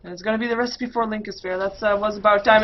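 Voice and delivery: normal talking voice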